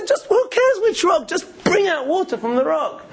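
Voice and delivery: high-pitched imitation of jeering; making fun. Crowd heckling Moshe about which rock, making fun. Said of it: "starting making fun".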